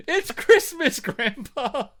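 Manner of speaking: high, loud excited kid impression; child's yell. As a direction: yell it out like an overjoyed child in a holiday movie. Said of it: "yells out".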